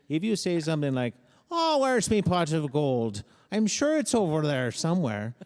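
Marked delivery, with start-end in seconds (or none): 1.5-5.3 hammed-up Irish leprechaun, louder and higher; Irish leprechaun accent. Shown as a demonstration. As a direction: put on a jolly Irish leprechaun lilt. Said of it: "you can jump straight into leprechaun mode".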